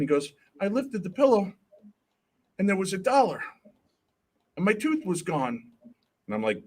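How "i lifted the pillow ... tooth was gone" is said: higher, lightly voiced small son; little boy. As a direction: retell it as an earnest little kid. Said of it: "he's very little".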